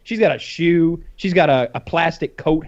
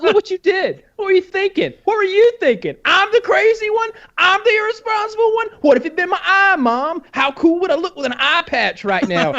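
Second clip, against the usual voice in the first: very high, whiny accusing voice; whiny indignant kid; 13 semitones higher than usual. Retold or imitated. Hurt child scolding his mom, high whiny rant. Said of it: "I was like".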